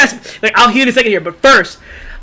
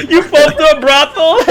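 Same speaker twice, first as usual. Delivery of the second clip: laughing; 7 semitones higher, 3 dB louder than usual. Real, not acted. Cracking up at own joke.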